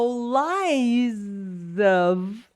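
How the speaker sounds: LIES OF joined in one very slow glide, lie-zov; linked words. Shown as a demonstration. Teacher shows connecting, "lies of" slowly linked as lie-zov. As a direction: stretch "lies of", carrying the Z into the vowel. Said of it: "you're going to connect".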